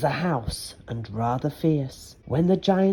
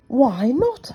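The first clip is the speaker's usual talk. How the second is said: cross little sister; 8 semitones higher, 4 dB louder than usual. Acted. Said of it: "who was a bit cross at being wakened up".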